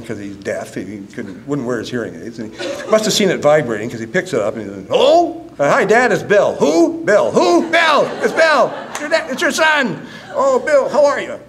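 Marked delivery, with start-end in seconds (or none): 4.9-10.0 shouting into the phone. Retold or imitated. Shouting to deaf dad on phone. 10.3-11.4 put-on a deaf old man half hearing; deaf old father. Deaf father voiced on phone.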